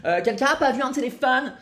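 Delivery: high, loud call across the bar. Retold or imitated. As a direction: shout it over the crowd. Calling out in a bar.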